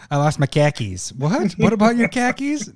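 hammed-up Boston accent, "khakis" pronounced with flat broad vowels; mock Boston accent. Host riffs a Boston joke about khakis in a hammed-up Boston accent. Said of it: "are we from Boston now".

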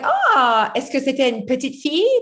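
lightly voiced curious mother; imitating mom. Mom quizzing [name] about the girl, lightly imitated. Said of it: "my mom was like".

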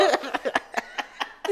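airy high giggle; breathless giggle. Real, not acted. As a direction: wheeze out a giggle. Laughter during role play.

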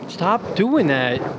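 very slow stretched stop doing that; drawn-out plea. Real, not acted. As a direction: drag it out, exasperated. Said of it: "mic rubbing, annoyed plea".

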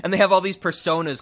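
ordinary talk

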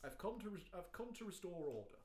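lightly put-on RP announcement; mock RP accent. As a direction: make a mock-formal RP declaration. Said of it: "doing R P all the time".

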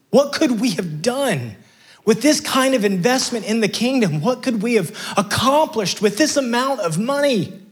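indignant disciples. Retold or imitated. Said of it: "the disciples are looking and they're going".

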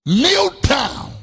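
kneel down shouted high and loud; shouted command. Real, not acted. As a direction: demand it from the whole church. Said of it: "preacher commands, repeats".

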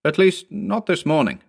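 high, defensive qualification; rather sharp. A narrator's reading. Herr Jensen qualifies his denial to Anderson, rather sharply. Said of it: "rather sharply".

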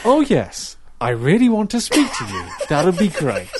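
high, laughing, forced politeness; fake cheerful. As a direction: sound falsely delighted. Fake cheerful while angry.

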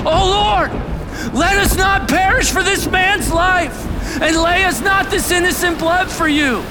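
very high, loud plea; shouted desperate prayer. Acted. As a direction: shout to God over a raging storm. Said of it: "they're shouting".

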